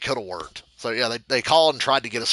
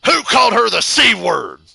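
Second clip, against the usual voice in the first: angry boss; 8 semitones higher, 7 dB louder than usual. Retold or imitated.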